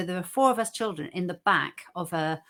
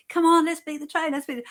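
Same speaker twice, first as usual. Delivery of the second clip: mock shout; 9 semitones higher, 6 dB louder than usual. Brothers shouting to dad.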